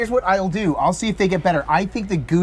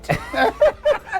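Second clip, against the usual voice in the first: high laugh. Real, not acted. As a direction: burst of high-pitched laughter. Laughing at own joke.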